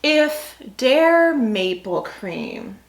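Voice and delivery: normal talking voice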